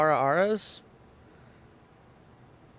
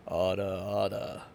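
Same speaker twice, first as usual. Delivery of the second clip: deep voice; 9 semitones lower, 3 dB quieter, slower than usual. Shown as a demonstration. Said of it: "You just gotta drop to the right octave".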